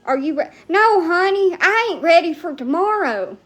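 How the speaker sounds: normal talking voice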